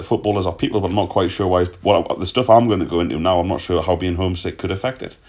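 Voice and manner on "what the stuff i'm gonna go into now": usual speaking voice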